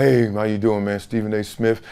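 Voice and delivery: soft, low, mild-mannered hello; a young reporter meeting an NFL player, mild and quiet; mild-mannered greeting. Retold or imitated. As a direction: introduce yourself gently and low. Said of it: "And he was like".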